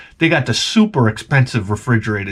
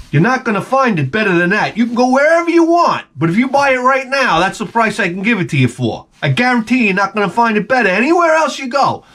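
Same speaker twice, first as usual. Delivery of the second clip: Long Island salesman; 7 semitones higher than usual. Retold or imitated. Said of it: "Long Island accent salesman".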